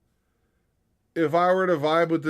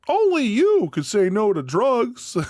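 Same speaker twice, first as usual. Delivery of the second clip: lecturing dog; 8 semitones higher, 4 dB louder than usual. Pretend. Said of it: "He'd be like".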